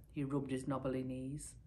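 ordinary delivery, nothing marked

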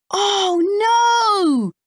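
put-on high, loud boy's cry of alarm; shocked cry. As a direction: yelp it out in dismay. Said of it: "says Max".

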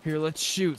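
usual speaking voice

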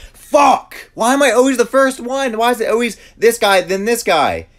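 very high, full-volume rage; losing it over being voted out; furious screaming. Real, not acted. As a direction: scream it in real outraged disbelief. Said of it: "I actually got mad".

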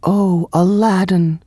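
high, emotional cry without shouting. A narrator's reading. Princess greeting Aladdin, crying out in a high voice. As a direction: cry out to a beloved, pitched high. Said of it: "cried Princess Zadia".

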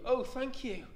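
the relieved witch gushing to her rescuers; grateful cry. Acted. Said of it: "the grateful witch cried".

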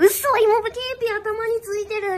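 高めの声で慌てて驚く; 恥ずかしい事に気づいた女の子の真似; 焦りの芝居. Acted. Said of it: "冷や汗の方だからね". (大げさに動揺して)

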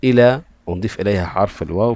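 normal talking voice

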